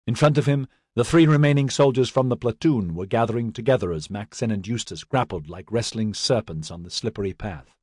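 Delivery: plain reading voice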